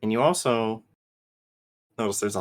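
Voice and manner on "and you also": ordinary delivery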